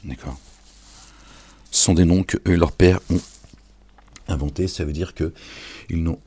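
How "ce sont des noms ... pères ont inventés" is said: loud emphatic insistence. Real, not acted. Hammering home his point.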